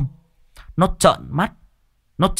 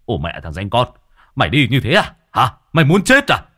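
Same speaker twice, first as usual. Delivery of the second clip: angry yell; 2 semitones higher, 5 dB louder than usual. A narrator's reading. Truck driver grabs Nôm and yells angrily. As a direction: bark at him like an enraged trucker.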